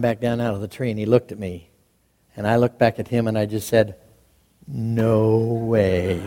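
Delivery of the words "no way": low, slow, drawn-out refusal; deadpan refusal. Retold or imitated. Deadpan refusal to uncle's tail-pulling.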